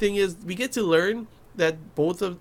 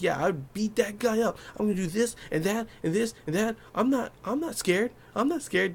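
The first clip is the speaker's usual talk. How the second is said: mock bravado; 5 semitones higher, 4 dB quieter than usual. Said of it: "acting like I'm calm".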